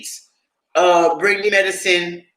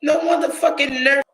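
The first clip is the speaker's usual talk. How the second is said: outraged shout; 7 semitones higher than usual. Retold or imitated. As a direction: yell it in disbelief. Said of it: "I was like".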